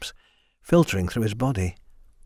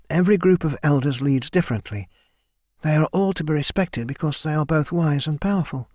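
higher, eager recitation of memorized facts; young Mina reciting; 4 semitones higher than usual. Acted. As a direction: rattle off the lesson like a dutiful student. Mina recites lesson to Copper.

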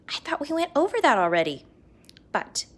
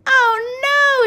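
loud, high cry of dismay; a read-aloud mock shout of horror; 12 semitones higher, 8 dB louder than usual. A narrator's reading. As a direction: wail it out like a panicked teacher. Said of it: "shouted Mister Cooper".

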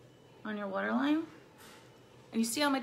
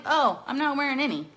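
mock reply, louder; 4 semitones higher, 7 dB louder than usual. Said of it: "And then I'm like".

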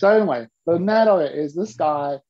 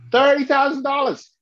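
astonished blurt; 9 semitones higher, 3 dB louder than usual. Retold or imitated. Shocked at his own price.

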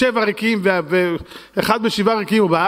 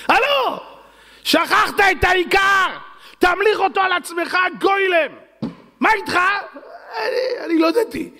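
high mocking scold; 12 semitones higher than usual. Voice scolds man who forgot to crown God over himself, high mocking shout.

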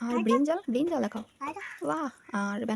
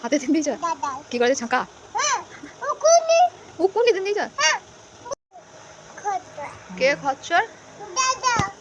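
loud, at times much higher, shouted questions; loud calling; 6 semitones higher, 9 dB louder than usual. Real, not acted. Family members shouting questions across the house.

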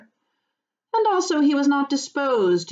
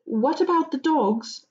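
higher, mildly worried question; anxious; 8 semitones higher than usual. Acted. Frodo asking about the guard dogs, anxious. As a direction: ask with a touch of nerves. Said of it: "asked Frodo anxiously".

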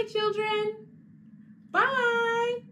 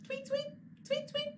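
high chirping tweet tweet; bird tweets; 5 dB quieter than usual. Pretend. Teacher plays the red bird for kids, chirping.

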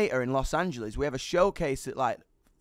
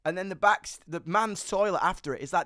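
ordinary talk

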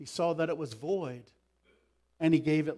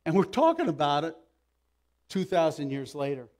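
ordinary talk

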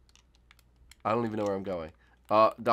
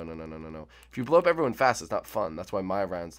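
usual speaking voice

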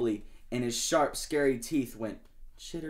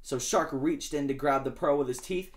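ordinary delivery

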